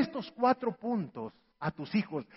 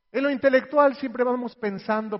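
ordinary delivery

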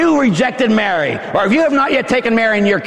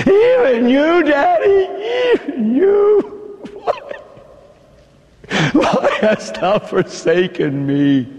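crying plea; 8 semitones higher than usual. Acted. Jesus's cry to the Father.

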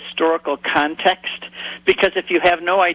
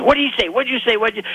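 mock fishermen yelling; 8 semitones higher, much faster than usual. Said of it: "yelling back and forth in Hebrew".

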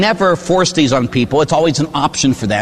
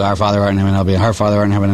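low, hurried recital of a rote prayer; rattled-off prayer; 6 semitones lower, much faster than usual. Retold or imitated. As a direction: imitate someone racing through penance. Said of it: "they just say these prayers really, really fast".

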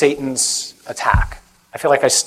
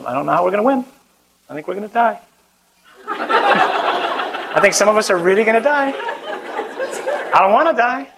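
hammed-up reluctance, rising higher at each ending; mock dread; 6 semitones higher than usual. Preacher confessing fear of martyrdom to crowd, mock dread. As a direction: pitch climbing into comic worry. Comic despair at losing the battle.

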